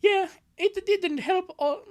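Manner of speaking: high, slow put-on girl voice; high character voice. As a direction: squeak it out slowly like a cartoon girl. Comic dub reading character line.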